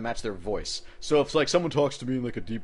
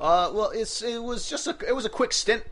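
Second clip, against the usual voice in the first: put-on British accent; 9 semitones higher than usual. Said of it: "British accent".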